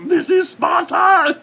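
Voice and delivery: very high, loud squeaky puppet shout; high Muppet-voiced Sparta shout. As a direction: shout it like a tiny felt puppet. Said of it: "I sound like a Muppet".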